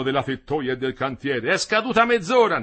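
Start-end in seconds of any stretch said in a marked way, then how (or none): 1.5-2.6 high, loud announcement; calling out. A narrator's reading. Foreman telling the workers time is up, calling loudly. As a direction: call it out for all to hear. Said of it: "gridò Madì".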